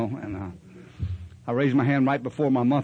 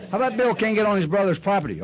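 raised, pushy mock questioning; mocking sponsor voice; 7 semitones higher than usual. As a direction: needle him like a sponsor testing him. Mock sponsor quizzing him.

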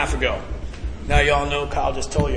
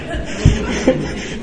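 airy laugh. Real, not acted. Laughs mid-quote.

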